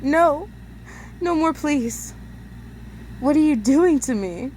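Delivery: pleading. Acted. Pleading in pain.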